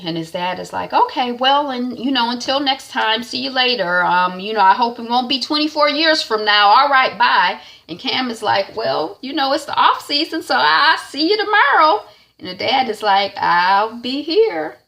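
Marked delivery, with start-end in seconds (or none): 0.9-7.6 higher, louder, upbeat dad voice; a light imitation of a dad brightly signing off; dad's cheery goodbye. 8.7-12.0 higher, louder, light imitation of Cam; tossing off a cheerful see-you-tomorrow; Cam's bright reply. Cam voiced answering his dad. 13.3-14.8 slow, higher, drawn-out dad voice; dad's slow reply. Retold or imitated.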